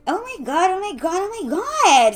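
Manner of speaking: high, loud repeated exclamations; flustered stammering. A narrator's reading. Jessica spotting Bobby, flustered and stammering. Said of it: "stammered Jessica".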